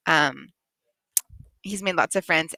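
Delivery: plain reading voice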